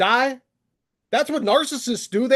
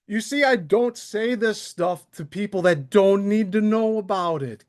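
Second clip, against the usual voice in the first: normal talking voice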